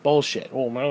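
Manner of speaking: ordinary talk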